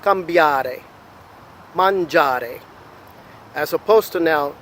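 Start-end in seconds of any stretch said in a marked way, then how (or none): 0.0-0.8 "cambiare" with the emphasis on BIA; stress on middle syllable. Shown as a demonstration. Teacher listing ARE verbs for learners, stressing BIA in "cambiare". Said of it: "emphasis will generally fall in the middle". 1.7-2.6 "mangiare" with the emphasis on GIA; stress on middle syllable. Shown as a demonstration. Teacher listing ARE verbs for learners, stressing GIA in "mangiare". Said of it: "emphasis will generally fall in the middle".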